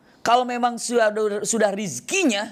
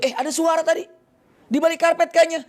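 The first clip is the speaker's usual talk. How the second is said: much higher, startled voice; surprised traveler; 10 semitones higher, 3 dB louder than usual. Acted. Traveler hears a sound behind the mosque carpet, startled high voice. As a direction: perk up at a strange noise, pitched high.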